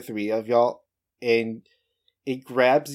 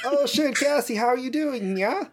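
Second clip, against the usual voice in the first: much higher goofy character voice; Catboy Biden; 13 semitones higher than usual. Acted. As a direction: play a silly high-pitched dude. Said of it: "the internet user known as Catboy Biden".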